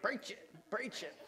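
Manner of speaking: high, soft, drawn-out encouragement; head-wagging sass. Shown as a demonstration. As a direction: say it slowly, head going with each word. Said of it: "say it with your head going like this".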